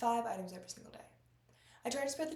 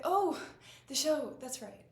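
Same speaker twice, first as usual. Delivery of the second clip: loud, high "oh" easing back down; startled realisation, settling as it lands; sudden recollection; 5 semitones higher, 7 dB louder, slower than usual. Acted. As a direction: jump as the memory returns.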